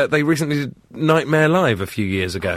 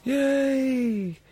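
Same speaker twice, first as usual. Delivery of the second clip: mock tiny cheer; 11 semitones higher, 7 dB quieter than usual. Host echoes the tiny cheer.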